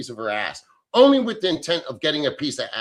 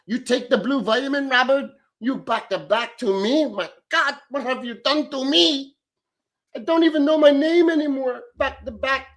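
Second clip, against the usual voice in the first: put-on higher, camp girlish voice praising a donor; mock girl; 8 semitones higher than usual. Thanking donor Robert, a higher mock swooning girl voice.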